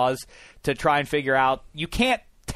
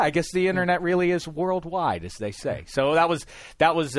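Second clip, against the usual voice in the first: normal talking voice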